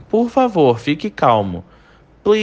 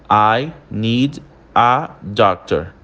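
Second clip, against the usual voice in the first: slow "I need a doctor" with gaps between the words; pausado; 4 semitones lower, slower than usual. Shown as a demonstration. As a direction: separate every word, keeping the D of "need" distinct. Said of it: "quando a gente fala essa frase pausada". (bem pausado, separando as palavras)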